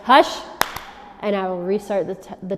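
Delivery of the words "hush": sharp, loud and higher hush; firm hush command. Shown as a demonstration. Telling the barking dog to hush, sharp and loud. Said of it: "I will say".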